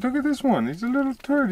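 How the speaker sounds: pet talk to animal. Real, not acted. Talking to a small wild animal at the water, high pet talk.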